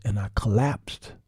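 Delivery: breathy collapse into a whisper; collapsing whisper. Retold or imitated. Husband telling how he collapsed in his wife's arms, whispering. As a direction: fade to a broken whisper as you fall into her arms.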